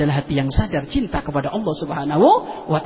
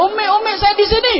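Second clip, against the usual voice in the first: high, loud call across a distance; child calling out; 5 dB louder than usual. Retold or imitated. Lost child calling to his mother from afar, loud high call. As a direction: call out to mother from far away.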